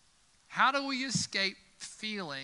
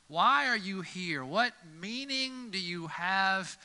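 slow and quiet, weighing each word; earnest preacher slowing down; slow, deliberate question; 3 semitones higher, 3 dB quieter, slower than usual. Real, not acted. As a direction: let the question sink in slowly. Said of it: "preacher slows for the key question".

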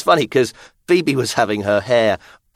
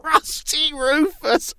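squealing with laughter, high; laughing; slower than usual. Real, not acted. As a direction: laugh through the name. Laughing, repeating the silly name.

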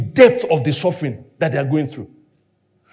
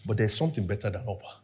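very soft; 12 semitones lower, 15 dB quieter, much faster than usual. Real, not acted. Preacher quietly weighs Orpah against Ruth, very soft. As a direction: lower and much softer, almost murmured. Very soft after long pause.

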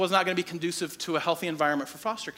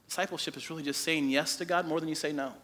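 normal talking voice

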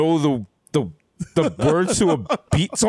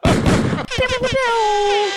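high-pitched pew-pew gun noises; cartoonish shooting sounds; mock gunfire; much slower than usual. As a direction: play laser-high pretend gunshots. Imitating gunfire at Shootout City.

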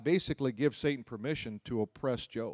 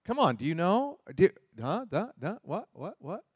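a hint of teasing taunt, higher; smart aleck; 8 semitones higher than usual. God taunting Job, lightly mocking smart aleck. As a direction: taunt like a smug kid. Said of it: "is that like smart aleck or what".

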